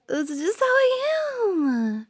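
high, drawn-out pouty whine; defensive whine. Acted. Girlfriend excuses her jealousy to partner, whiny and defensive. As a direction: whine it upward, then let it fall.

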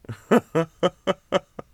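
high, loud burst of laughter; high bright laugh. Real, not acted. Laughs at partner.

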